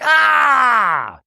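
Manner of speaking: high, loud imitated shriek of frustration; mock frustrated shriek. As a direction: let out a hammed-up exasperated yell. Shannon's outburst.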